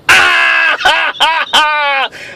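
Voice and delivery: real laugh bursting out high and shouted; big hearty cackle. Real, not acted. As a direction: crack up at your own punchline. Laughs at own joke.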